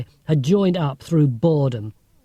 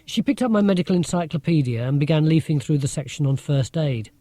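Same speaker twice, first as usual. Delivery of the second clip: ordinary delivery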